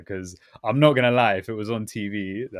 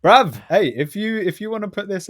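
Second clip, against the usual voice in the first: Cockney; 11 semitones higher, 8 dB louder than usual. Shown as a demonstration. Slips back into Cockney.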